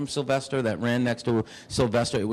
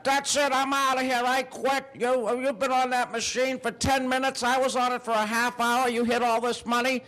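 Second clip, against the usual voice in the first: put-on high crotchety old man, huffy; angry old man; 12 semitones higher than usual. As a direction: squeak out an old man's tantrum. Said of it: "he gets mad".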